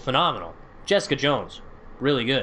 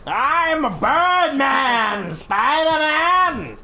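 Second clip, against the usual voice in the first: high, slow, loud triumphant cry; drawn-out gleeful yell; 11 semitones higher, 4 dB louder, much slower than usual. Pretend. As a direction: stretch it out, shouting with joy. Gleeful yell while swinging.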